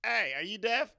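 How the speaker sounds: loud, high mocking call; taunting yell. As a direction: shout it up the hill to taunt him. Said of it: "we was like".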